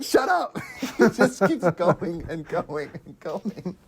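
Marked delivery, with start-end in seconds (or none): 0.0-0.6 mock shout. Host acting out a character snapping shut up with a shout. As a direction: yell it at the coughing guy.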